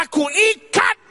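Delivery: high, forceful exorcism command; loud, high-pitched rebuke; commanding shout. Acted. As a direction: order the demon with full authority. Acts believer commanding a demon.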